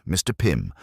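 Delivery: ordinary delivery, nothing marked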